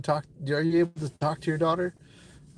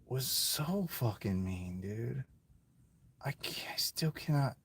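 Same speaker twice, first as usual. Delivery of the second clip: fighting back tears, barely audible; choked up; 2 semitones lower, 11 dB quieter than usual. Real, not acted. Telling the interviewer about his ex, near tears. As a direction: voice dropping to a near whisper. Breaking down over his ex-girlfriend.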